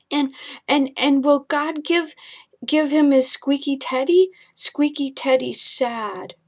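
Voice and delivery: put-on a small boy asking if the monster is gone; scared little boy. Ethan asks his mother about the monster, high and small.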